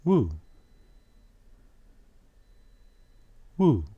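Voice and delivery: Woo held long, rounded; drawn-out woo. Shown as a demonstration. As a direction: model Woo slowly, lips rounded. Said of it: "How do you pronounce the W?".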